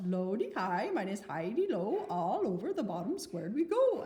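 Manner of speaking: mock Swedish chef singsong; Swedish accent. Shown as a demonstration. Teacher shows the mnemonic in a silly Swedish accent. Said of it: "try out with a Swedish accent".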